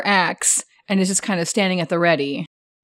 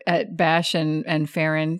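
ordinary talk, nothing marked

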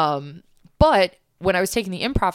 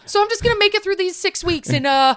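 high, loud mock breezy brush-off; exaggerated sarcastic okay-cool voice; sarcastic inner voice; 9 dB louder than usual. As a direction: shrug it off in a high sing-song. Mocking her own reaction in class.

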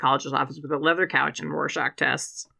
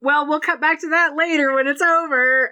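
breezy announcer voice; 9 semitones higher than usual. Retold or imitated. Imagined commentators cutting away from the match, in a breezy mock announcer voice. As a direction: toss it away like a casual TV host. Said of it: "they were just like".

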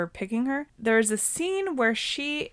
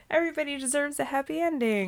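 lightly mocking, higher and quicker; mocking quote; 7 semitones higher, faster than usual. Mocking filmmakers who pair off side characters, lightly put-on high voice. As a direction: toss it off as a pat movie moral. Mimicking romantic movie makers' logic.

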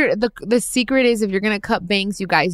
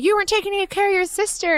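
mock angry mother, pitched up high; scolding mom; 11 semitones higher than usual. Mom scolding her daughter for not watching sister, hammed-up pitched-up voice. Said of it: "but she's like".

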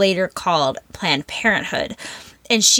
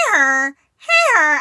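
mocking braying hanger, louder and higher; donkey-like hanger; 5 semitones higher, 4 dB louder than usual. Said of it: "this is how he thinks I sound".

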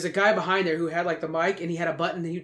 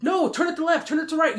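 high, loud yelled instructions; retold yelling; 9 semitones higher, 3 dB louder than usual. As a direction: shout it like cheering at the TV. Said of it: "he'd be yelling, like".

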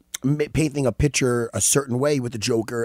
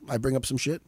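ordinary talk, nothing marked

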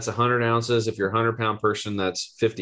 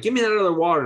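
high, louder demand for more; eager; 9 semitones higher, 3 dB louder, faster than usual. Retold or imitated. Asking Danusha for the next quart, eager and high. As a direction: grab for it like a kid wanting more.

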